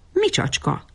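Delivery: high-pitched wary question; sudden high note of suspicion; suspicious. A narrator's reading. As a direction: query it with sharply lifted pitch and distrust. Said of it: "homlokát a gyanakvás, baljós árnyai felhőzték".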